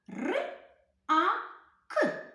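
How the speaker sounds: each sound said apart, high and clear; separate sounds. Shown as a demonstration. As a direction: sound out Р, А, К one by one. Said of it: "Поєднайте їх".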